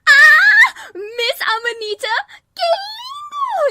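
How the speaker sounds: shrieking very high and very loud, last word stretched slowly; freaking out. Acted. Fan freaks out on hearing her idol's line, shrill squeal. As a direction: squeal like a fan losing control. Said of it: "she just freaks out".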